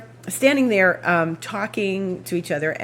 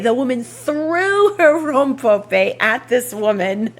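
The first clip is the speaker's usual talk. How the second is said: laughing; 6 semitones higher, 5 dB louder, slower than usual. Real, not acted. Laughing at the rompope throw.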